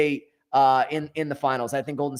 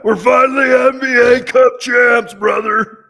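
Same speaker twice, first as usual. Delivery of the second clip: hoarse, shouted coach impression; Tom Thibodeau; 7 semitones higher, 11 dB louder, slower than usual. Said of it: "with Tom Thibodeau in that hoarse voice".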